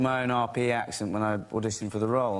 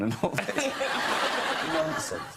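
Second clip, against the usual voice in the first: ordinary delivery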